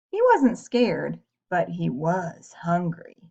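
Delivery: ordinary delivery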